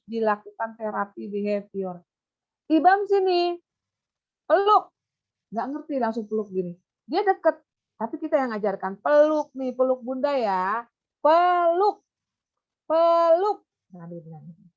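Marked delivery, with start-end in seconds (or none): 2.7-4.9 high, louder coaxing call; teacher inviting a pupil to hug; coaxing child. Shown as a demonstration. 9.0-13.7 high, loud coaxing with rising ends; mother coaxing a child to hug. Shown as a demonstration.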